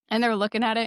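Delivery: ordinary delivery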